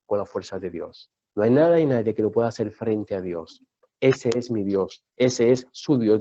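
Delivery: deep, low, weighty preacher; solemn preaching. Real, not acted. Preacher telling the church nothing compares to God, solemn. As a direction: declare it gravely, pitched far down.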